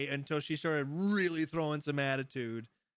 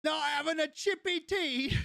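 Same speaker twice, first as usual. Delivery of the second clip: a much higher lightly put-on British accent; mock British accent; 12 semitones higher than usual. Streamer reading a viewer's chat in a jokey British accent. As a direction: read out the chat line in a playful English accent. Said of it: "speaking of British accents or English accents".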